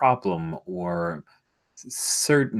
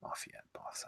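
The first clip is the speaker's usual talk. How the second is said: whisper; 17 dB quieter, faster than usual. Real, not acted. Hushed apology after the bit.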